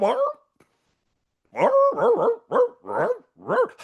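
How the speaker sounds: very high growling dog noises; dog growls. Retold or imitated. Old man spotting a young woman, mock dog growling. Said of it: "the dog raises up".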